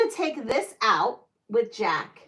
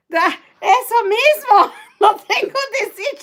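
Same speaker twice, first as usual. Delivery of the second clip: high, louder Spanish through laughter; flustered laughing; 9 semitones higher, 7 dB louder than usual. Real, not acted. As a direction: laugh while fumbling for words.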